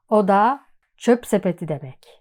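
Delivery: ordinary talk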